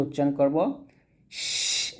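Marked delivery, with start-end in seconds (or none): 1.3-1.9 শ- as breathy rushing air; airy sh release. Shown as a demonstration. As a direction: let air out on শ-. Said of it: "এরকম একটা বাতাস বের করে". (বাতাস বের করে শ)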